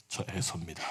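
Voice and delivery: low, quiet put-on deep voice; faked deep voice. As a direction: Jacob dropping his voice to pass as his brother. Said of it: "낮은 목소리를 흉내 냈는데".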